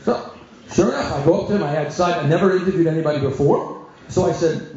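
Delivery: ordinary delivery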